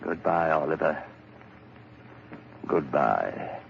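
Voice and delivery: low, hushed goodbye; a quiet, final goodbye over the grave; soft farewell. Acted. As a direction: bid the dead man farewell softly. Farewell to the buried victim.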